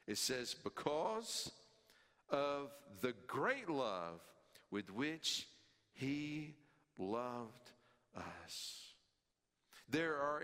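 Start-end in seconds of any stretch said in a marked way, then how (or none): 0.5-8.8 each word spaced out slowly; measured word-by-word scripture reading; slow emphasis. Shown as a demonstration. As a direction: lay out every word with pauses. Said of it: "drawn out word by word".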